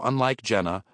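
usual speaking voice

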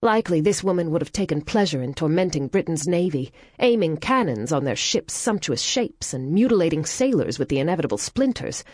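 ordinary delivery, nothing marked

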